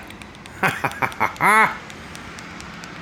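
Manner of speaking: long, high-pitched cackle of relief; triumphant laugh. Real, not acted. Man amazed his repair worked, long delighted laugh. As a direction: laugh it out in rising bursts.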